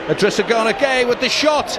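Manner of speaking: loud, high commentary burst; excited shot call. Real, not acted. Commentator calls a shot at goal, loud and excited. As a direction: lift volume and pitch as the shot goes in.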